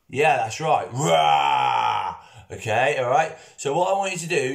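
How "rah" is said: a loud growling dinosaur roar; T-Rex roar. Shown as a demonstration. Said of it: "what noise a T-Rex makes".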